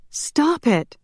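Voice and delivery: very high cry without full volume; exasperated scream. A narrator's reading. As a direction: shriek it in a restrained way. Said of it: "Shanna screamed".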